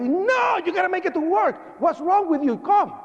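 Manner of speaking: high-pitched imitation of an irate boss; going crazy. Supervisor refusing to let his worker stay home, going crazy. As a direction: act out the boss losing it, pitch way up. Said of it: "And he went crazy".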